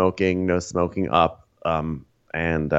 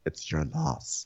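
echoed sneer; 8 semitones higher, 11 dB quieter, slower than usual. Retold or imitated. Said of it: "repeated slower, softer echo".

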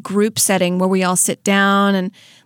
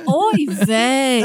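put-on Yiddish accent; 2 semitones higher than usual. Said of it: "he's like".

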